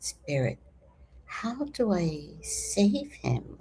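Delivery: soft slow whisper to Spirit; whispered plea. Retold or imitated. Asking Spirit for help, whispered slowly. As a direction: breathe the question like a prayer.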